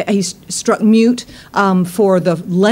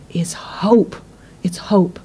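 whispered; 2 semitones lower, 3 dB quieter, slower than usual. Real, not acted. Repeating key word softly.

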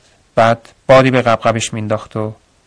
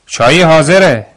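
loud, higher, friendly call to everyone; pleasant call; 5 semitones higher, 6 dB louder than usual. A narrator's reading. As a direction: call the workers over for tea. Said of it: "صدای دعوت دلپذیر خاله گزل". (صدا زدن بلند و دلپذیر برای چای)

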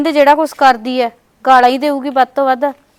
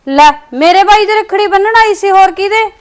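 higher, louder indignant reply; insist, hurt, raising your voice; upset protest; 8 semitones higher, 5 dB louder than usual. Acted. Sister protesting to sister-in-law. (ਨਨਾਣ ਭਾਬੀ ਅੱਗੇ ਉੱਚੀ ਅਵਾਜ਼ 'ਚ ਰੋਸ ਕਰਦੀ)

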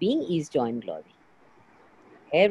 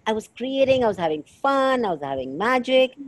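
lightly mimicked excitement, pitched higher; acted-out client excitement; 6 semitones higher, 3 dB louder than usual. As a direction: quote the client's enthusiasm in a lilt. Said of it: "you were like".

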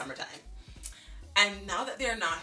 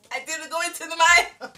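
high, loud insistence; loud protest; 10 semitones higher, 12 dB louder than usual. Retold or imitated. Child protesting to her dad, high and loud. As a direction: shout the denial. Outburst after the sigh.

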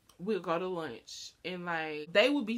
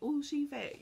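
catty remark; 7 semitones higher than usual. Retold or imitated. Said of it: "Or you can say".

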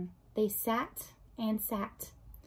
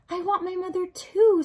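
usual speaking voice

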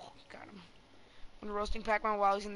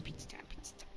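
whispered chant; 15 dB quieter than usual. Real, not acted. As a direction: whisper it under your breath. Chanting pizza time, fading to whisper.

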